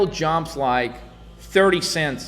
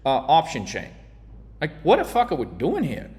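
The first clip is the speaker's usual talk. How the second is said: normal talking voice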